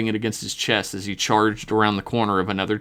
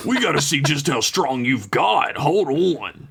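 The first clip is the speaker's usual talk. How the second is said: eager dad; 4 semitones higher than usual. A narrator's reading. Dad excited by son's strength.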